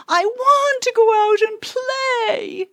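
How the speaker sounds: put-on high, slow, crying child's whine; a kid wailing to be let out to play; whiny child. Child whining at mom to go play, high crying whine. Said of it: "it might look like this".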